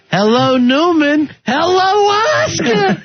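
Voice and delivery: high slow sneering greeting. Acted. Host reads a listener's Seinfeld joke in a high, slow mock-sneering voice. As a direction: drag out each hello.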